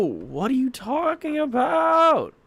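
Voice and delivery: high, loud, slow, outraged question; exasperated shout. Real, not acted. Frustrated player yelling at the game's nonsense alert, outraged. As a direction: shout it at the screen in disbelief.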